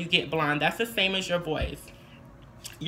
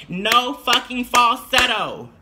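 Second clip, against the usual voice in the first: emphatic; 5 semitones higher, 7 dB louder, slower than usual. Real, not acted. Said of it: "emphatic warning".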